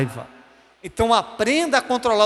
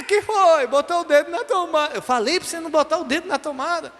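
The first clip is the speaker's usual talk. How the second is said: higher-pitched exasperated telling-off; scolding parent; 7 semitones higher than usual. Acted. Parent reacting to the crying boy, higher exasperated scolding. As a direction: scold the child you already warned.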